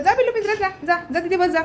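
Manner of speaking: high-pitched pet command; high, coaxing telling-off; ordering a pet. Real, not acted. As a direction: shoo a naughty pet off in a high voice. Sending the pet to its cage.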